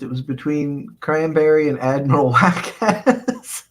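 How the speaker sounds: words slowing as laughter takes over; breaking into laughter. Real, not acted. Streamer reading a chat comment, breaking into real laughter. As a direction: start reading, then crack up on the name.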